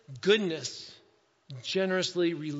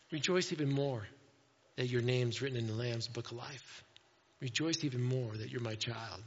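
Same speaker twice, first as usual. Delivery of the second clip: tender; 7 semitones lower, 7 dB quieter than usual. Retold or imitated. Jesus gently redirects his disciples.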